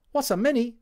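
Tommy repeating it, high and loud; Cockney soldier voice. Acted. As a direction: echo the question cheerfully as Tommy.

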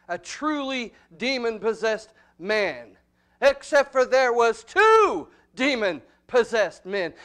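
full-force pulpit preaching. Acted. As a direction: preach it hard to the congregation. Preacher slow loud emphasis.

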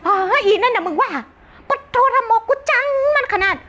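high-pitched busybody auntie squeal; gossipy aunt. Retold or imitated. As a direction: ham up the nosy aunt dishing dirt. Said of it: "มันจะพูดเรื่องชาวบ้านนะ ป้าคนเนี้ย".

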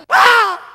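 sudden piercing scream, high and loud. Retold or imitated. A child screaming after the whooping. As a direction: let out a full childish scream.

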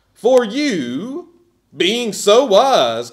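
slow, loud mock praise, leaning on so; sarcastic. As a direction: drip sarcasm over every word. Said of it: "remember that sarcasm".

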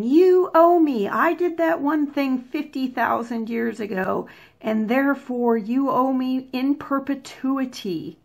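teasing someone who keeps calling in an old favour, in a mock queenly voice; mock Daenerys Targaryen. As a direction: ham up an entitled royal claiming a debt. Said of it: "Thank you, Daenerys Targaryen".

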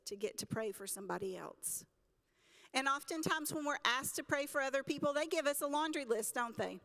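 ordinary talk

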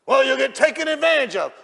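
high, loud mock protest; hammed-up yelled objection; shouted objection. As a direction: holler it like an indignant objector. Imagined objector, acted loudly.

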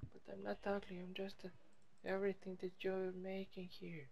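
high, soft little voice protesting; hurt little character. Pretend. Talking back to being called ugly, in a small high voice. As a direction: squeak back quietly in a put-on voice.

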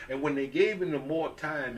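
usual speaking voice

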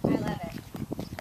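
whispered. Real, not acted. Aside whisper.

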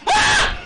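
shrill panicked scream; high scream. Real, not acted. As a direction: scream out loud. Scream in game.

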